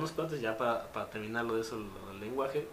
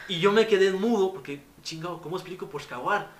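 excited retelling; 6 semitones higher, 10 dB louder than usual. Real, not acted. Retelling being left speechless.

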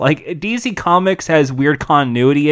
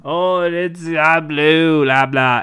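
mock Russian accent; 3 semitones higher, much slower than usual. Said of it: "that fake Russian accent".

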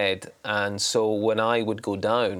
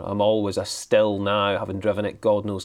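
ordinary delivery

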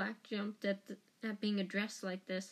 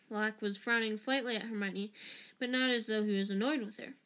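ordinary delivery, nothing marked

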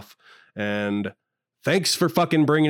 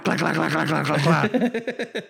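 gunfire noises; 3 semitones higher than usual. Pretend. Mimicking gunfire after grabbing pistol.

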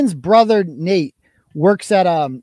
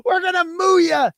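high, squeaky delight through laughter; laughing; 13 semitones higher than usual. Real, not acted. Host delighted by his own heckling plan, high laughing voice. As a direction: say it while cracking up, pitch way up. Cracking up at his mooing joke.

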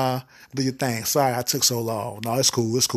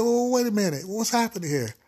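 high confused voice; 9 semitones higher than usual. Acted. Tim confused mid-act.

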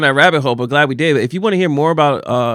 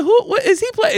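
baffled. Retold or imitated. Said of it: "I, I said".

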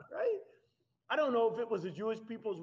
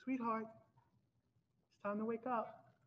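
soft, very slow, gentle wake-up call; a mother softly waking her daughter; tender mother waking child; 5 semitones higher, 5 dB quieter, much slower than usual. Retold or imitated. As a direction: coax a sleeping child awake tenderly.

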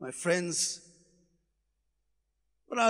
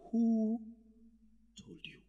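hushed voice of God; 4 semitones lower, 10 dB quieter than usual. Acted. God asking Adam in the garden, hushed. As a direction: drop to a near-silent, much softer question.

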